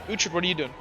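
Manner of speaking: usual speaking voice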